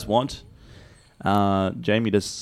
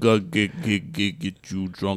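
Russian accent; 2 semitones lower than usual. Shown as a demonstration. Co-host feeds Jamie the line, Russian accent. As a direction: recite lyrics in a mock Russian accent. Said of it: "You're doing Russian today".

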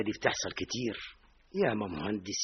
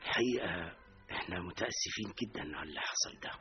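hushed; 4 semitones lower, 7 dB quieter than usual. Acted. Bad news told softly.

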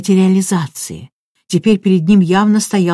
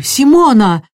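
loud, raised cry of alarm; shouting; 6 semitones higher, 6 dB louder, slower than usual. A narrator's reading. Raoul calling again to the suffering medium, alarmed shout. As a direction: call her name, voice leaping up. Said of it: "закричал Рауль".

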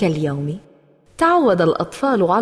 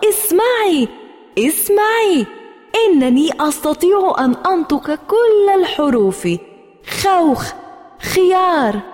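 joyful shout; 9 semitones higher than usual. Acted. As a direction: shout to mom, thrilled. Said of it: "فصرخت بأعلى صوتي". (طفلة تنادي أمها بفرح وصياح)

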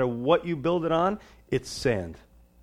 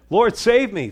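high, loud plea to be saved; desperate cry for help; 9 semitones higher, 8 dB louder, slower than usual. Retold or imitated. Peter sinking in the storm, begging the Lord, loud desperate cry. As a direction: cry it out like a man sinking in the waves. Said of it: "And Peter said".

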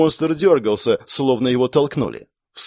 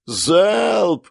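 высокий громкий выкрик; резко скомандовать залп; крик команды; 7 semitones higher than usual. Acted. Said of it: "закричала Клэр". (Клэр командует солдаткам, резкий крик)

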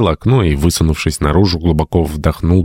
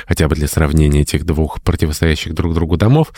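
ordinary delivery

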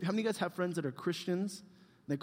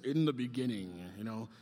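low, slow epic movie-trailer voice; grand dramatic narrator voice; Star Wars opening; 7 semitones lower, 5 dB quieter than usual. Retold or imitated. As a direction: intone it like a film's opening crawl. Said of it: "sounds like the beginning of Star Wars".

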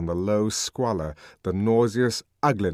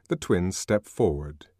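usual speaking voice

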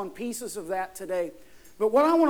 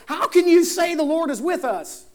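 loud, high-pitched challenge; incredulous protest; 7 semitones higher, 6 dB louder than usual. Acted. Gideon answering the angel who calls him valiant, incredulous. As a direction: object loudly, as if you can't believe it.

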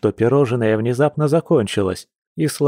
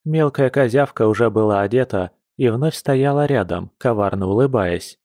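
ordinary delivery

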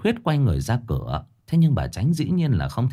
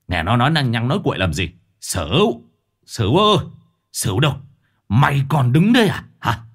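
louder, calling out angrily; shouting for servant; 3 semitones higher, 5 dB louder than usual. Acted. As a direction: bark for the servant to move. Master yells for servant.

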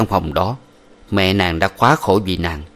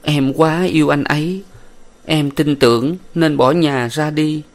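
sniffling; 7 semitones higher than usual. A narrator's reading. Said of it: "Nàng sụt sùi".

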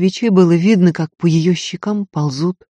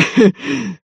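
pained laugh; 9 semitones higher than usual. Acted. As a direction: laugh out despite the pain. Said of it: "в голос расхохотался".

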